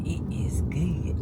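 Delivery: breathy whispered praise; hushed, savoring whisper. Real, not acted. Tasting cake, hushed repeat.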